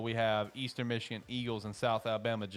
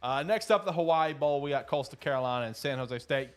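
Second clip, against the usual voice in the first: usual speaking voice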